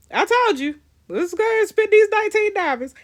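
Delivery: high, loud I-told-you-so; excited vindication. Real, not acted. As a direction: burst out, eager to go for it. Gleeful at paying for the choice.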